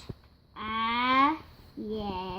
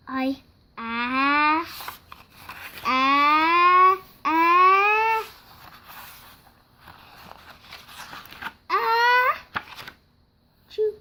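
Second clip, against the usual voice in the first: long drawn-out sneeze, rising to the burst; sneeze building up; 3 semitones higher, much slower than usual. Acted.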